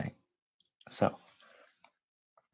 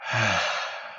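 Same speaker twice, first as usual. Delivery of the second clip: long, breathy exhale of relief; sigh of relief; 2 semitones higher, 5 dB louder than usual. Shown as a demonstration. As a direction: let out a comfortable, drawn-out sigh. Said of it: "comfortable sigh of relief".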